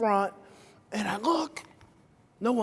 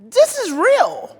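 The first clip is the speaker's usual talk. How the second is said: high, loud, slow emphatic this; insistent; 10 semitones higher, 9 dB louder, slower than usual. Retold or imitated. Speaker insisting to the audience, loud emphatic stress. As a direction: drive home that it's real. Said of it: "I'm like".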